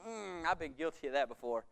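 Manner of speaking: ordinary talk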